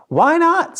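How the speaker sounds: high, loud cheerful chorus; happy townsfolk shout; crowd agreeing. A narrator's reading. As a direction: everyone agreeing at once. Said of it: "said all the people".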